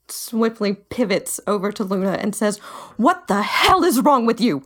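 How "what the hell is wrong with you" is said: angry confrontation. Acted. Ari confronts Luna angrily.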